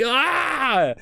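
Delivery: high mock scream of delight; excited crowd scream. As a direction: imitate a fan losing it. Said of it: "everyone gets so excited".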